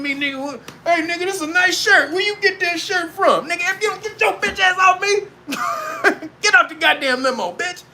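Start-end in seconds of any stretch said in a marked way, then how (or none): 0.8-3.5 higher, pestering voice; hanger-on in the limo admiring the speaker's shirt, higher; nosy hanger-on. Acted. 3.5-7.8 hammed-up shout to get off him; mock angry shout. Throwing the hanger-on out.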